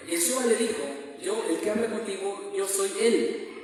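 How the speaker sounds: loud, ringing declaration of the verse; booming scripture quote; proclaimed reading. Real, not acted. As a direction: proclaim the words of Yeshua aloud to the congregation. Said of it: "Lo leo".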